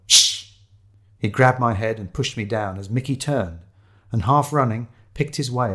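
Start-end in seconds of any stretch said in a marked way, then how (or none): 0.1-0.5 sharp, urgent shushing; hissed hush. A narrator's reading. Narrator hushing Shiloh, hissed. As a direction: hiss for silence. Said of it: "I hissed at Shiloh".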